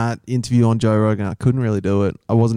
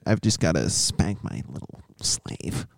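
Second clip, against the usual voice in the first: unvoiced whisper, quiet and low; whispered aside; 7 semitones lower, 7 dB quieter than usual. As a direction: hiss the aside away from the phone. Whispered aside in prank call.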